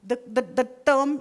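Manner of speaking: usual speaking voice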